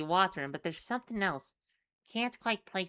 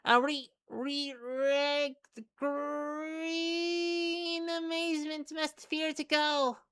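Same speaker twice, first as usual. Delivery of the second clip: high, slow, halting critter voice; creature voice; 8 semitones higher, slower than usual. Acted. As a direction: stammer like a tiny creature. Creature stumbles over words.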